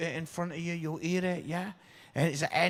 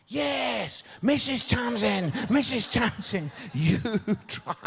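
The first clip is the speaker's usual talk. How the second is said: high, loud, delighted echo; laughing as he passes the eyeballs on; gleeful turnabout; 7 semitones higher, 5 dB louder than usual. Retold or imitated. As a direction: toss it back with a grin. Passing the eyeballs on to Tamsin.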